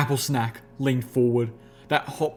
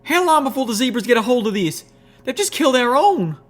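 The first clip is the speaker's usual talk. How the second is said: much higher, louder, tearful; tearful anger; 12 semitones higher, 5 dB louder than usual. A narrator's reading. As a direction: speak through tears and rage. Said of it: "tears in her eyes".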